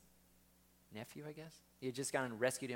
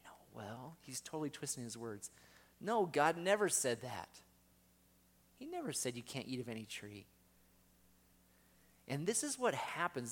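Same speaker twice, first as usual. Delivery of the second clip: ordinary talk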